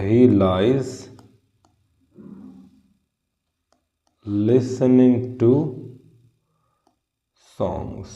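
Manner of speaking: very slow, spaced out; slow, drawn he lies listening to; slow dictation. Shown as a demonstration. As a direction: write it out while saying it slowly. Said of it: "यहाँ पर लाई है और listen है".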